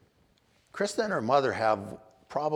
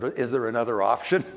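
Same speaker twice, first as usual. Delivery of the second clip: laughing quote; 2 semitones higher, 7 dB louder than usual. Retold or imitated. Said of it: "and he said".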